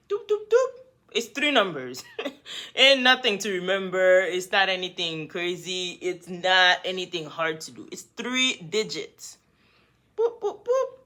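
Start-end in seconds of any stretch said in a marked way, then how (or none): 0.1-0.7 high beeping phone-dial noises; dial tones. Retold or imitated. Dialing the cops on him, high beeps. 10.2-11.0 high, laughing phone-dial beeps. Retold or imitated. Dialing the police on the boyfriend, laughing beeps.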